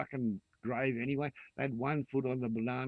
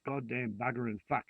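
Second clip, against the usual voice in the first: normal talking voice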